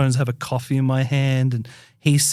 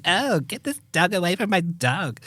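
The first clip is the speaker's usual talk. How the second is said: higher, put-on socialite whine; Paris Hilton; 4 semitones higher, 3 dB quieter than usual. Scooping up the little dog from another, mock socialite voice. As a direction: play a precious celebrity dog owner. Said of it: "like, Paris Hilton".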